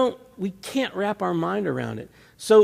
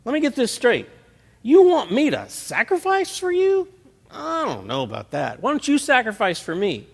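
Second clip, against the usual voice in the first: higher, louder, outraged whine at God; indignant pot; 7 semitones higher, 5 dB louder than usual. Pretend. Complaining believer as pot to God, higher and louder. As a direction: squeal it like an affronted pot. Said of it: "the pot looking at God going".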